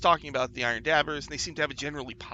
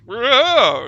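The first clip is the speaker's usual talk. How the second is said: loud, high startled whoa; startled door; 9 semitones higher, 17 dB louder than usual. Acted. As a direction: react like dirt was shoved in your mouth. Door reacts to dirt.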